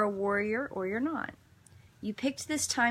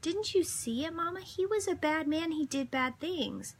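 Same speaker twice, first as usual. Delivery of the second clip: put-on high, earnest small-boy voice; imitating a small child, much higher; little son's voice; 9 semitones higher than usual. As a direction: play the four-year-old explaining to his mama. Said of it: "And he goes".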